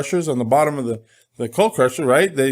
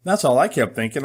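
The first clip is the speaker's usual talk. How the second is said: ordinary talk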